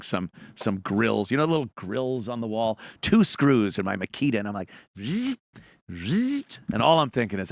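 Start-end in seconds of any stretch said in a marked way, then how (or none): 5.0-6.6 mock power drill buzz. Imitating his Makita drill whirring.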